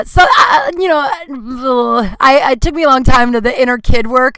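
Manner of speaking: sudden loud sob-cry, high and loud; the tears burst out mid-sentence. Real, not acted. Breaks down over inner child work.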